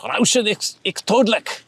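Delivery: high-pitched, hammed-up German reading; mock German accent. Shown as a demonstration. Reading the warning off a German tin, hammed-up German accent. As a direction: attempt the German with an exaggerated accent. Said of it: "It says, like".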